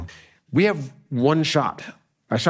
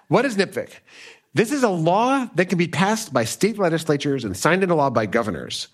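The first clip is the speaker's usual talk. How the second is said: ordinary talk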